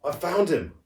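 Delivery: squeaked. Acted. Boy tells his sister his discovery, squeaky and high. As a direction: squeak it out with excitement. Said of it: "I squeaked".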